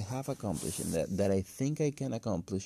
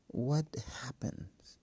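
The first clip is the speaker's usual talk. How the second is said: whisper; 5 semitones higher, 4 dB quieter, slower than usual. Real, not acted. Rhetorical aside, whispered.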